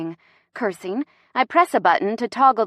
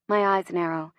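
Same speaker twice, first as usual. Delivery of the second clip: usual speaking voice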